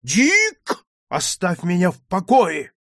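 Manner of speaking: loud, high, barely contained anger; straining for restraint. Acted. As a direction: fight to hold back, and fail. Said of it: "проговорил он всеми силами, стараясь сдержаться".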